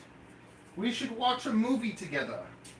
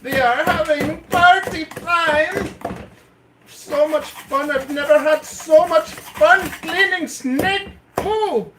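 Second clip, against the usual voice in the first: higher and much louder, slow and hammed-up; mock hype; 7 semitones higher, 9 dB louder than usual. Showing off snake-poo cleaning to chat, loud mock hype. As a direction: sell it like the best party ever.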